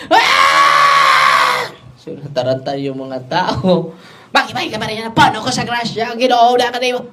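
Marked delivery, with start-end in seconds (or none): 0.1-1.7 loud guttural scream, voice dropped low; retold demonic scream. Priest imitating the possessed crowd, loud scream. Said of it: "sumisigaw na sila". 4.3-7.1 high, loud strained possessed voice; possessed woman. Retold or imitated. Priest voicing a possessed woman mocking the prayer, high and loud.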